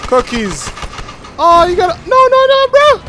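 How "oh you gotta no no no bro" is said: high alarmed no no no; panicked shout. Real, not acted. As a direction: panic rising in the voice. Panic as play goes wrong.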